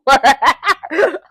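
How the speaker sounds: loud, high belly laugh; real loud cackling laugh; burst of laughter. Real, not acted. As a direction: crack up at yourself, loudly. Cracks up at own resemblance.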